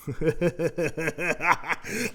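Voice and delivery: a short angry laugh; breathy laugh at his insult; mad laugh. Retold or imitated. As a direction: laugh in disbelief. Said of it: "I just started laughing".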